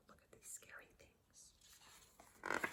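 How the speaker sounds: breathy spooky whisper; whispered aside. Acted. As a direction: lean in and whisper the spooky bit. Aside to listener.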